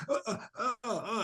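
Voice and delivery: high, soft mock retching grunts; imitated dry heaves, badly struggling for air; fake dry heaving. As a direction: pretend to dry heave in short gasps. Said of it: "pretending to, to dry heave".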